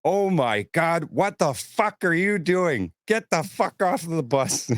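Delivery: high, slow mock yell of outrage; shocked angry yell. Gloria ordering the wasted fan off the bus, high outraged yell. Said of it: "she just goes".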